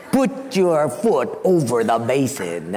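low, slow German nurse's order; a stern German nurse giving a curt order, slowly; German nurse accent. Retold or imitated. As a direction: nurse telling the boy where to put his foot, low, slow, German accent. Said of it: "had this real heavy German accent".

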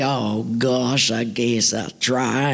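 low, slow, reluctant country voice; slow rustic refusal; old farmer. Acted. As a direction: decline like a bashful old farmer. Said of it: "Billy was this old farmer".

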